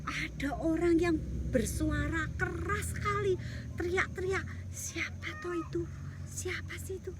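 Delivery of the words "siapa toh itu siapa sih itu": soft secret whisper; hushed whisper. Acted. David hides and whispers, curious. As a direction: whisper so nobody hears.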